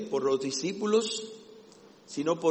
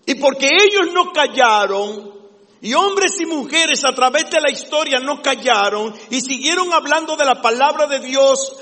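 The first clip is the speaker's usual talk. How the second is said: loud, higher preacher's cry; fiery preaching; 8 semitones higher, 9 dB louder than usual. Acted. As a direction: preach it with full fire to the congregation. Preaching at full force. (predicación fervorosa a plena voz)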